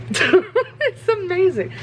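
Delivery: high, slow laugh through words. Real, not acted. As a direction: laugh it out, delighted.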